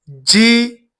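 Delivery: ordinary delivery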